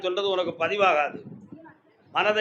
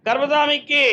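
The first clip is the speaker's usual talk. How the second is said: loud, higher call to the deity; chanted shout; ritual cry; 5 semitones higher, 4 dB louder than usual. Real, not acted. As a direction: call it out for the crowd to answer.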